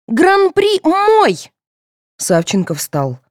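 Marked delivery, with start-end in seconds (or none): 0.1-1.5 very high, stretched-out loud claim; angry. A narrator's reading. Girl claiming the prize from her rival, angry. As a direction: stake your claim in a high, furious wail. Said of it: "разозлилась Соня".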